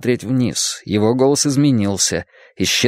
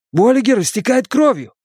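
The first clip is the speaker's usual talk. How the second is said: high, urgent, alarmed; desperate plea; 11 semitones higher than usual. Acted. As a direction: beg for help for the wounded. Wounded friend bleeding.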